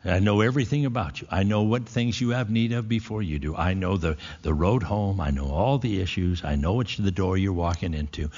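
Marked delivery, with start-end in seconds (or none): none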